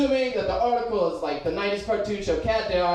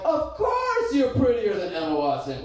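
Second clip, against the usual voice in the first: exaggerated sarcastic reassurance, pitched up; 6 semitones higher than usual. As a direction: overdo the compliment sarcastically. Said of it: "How do you convey sarcasm".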